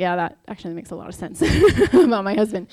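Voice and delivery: quick reply that spills into real laughter; amused laughing. Real, not acted. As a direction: break into a laugh mid-sentence. Laughs retelling a remark on her husband.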